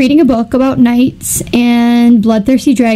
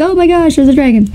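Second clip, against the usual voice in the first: mock alarm; 5 semitones higher, much faster than usual. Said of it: "warn the rest of the village".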